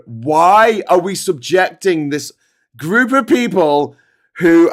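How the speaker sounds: slow, incredulous complaint that swells into fury; freaking out. Retold or imitated. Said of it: "freaking out".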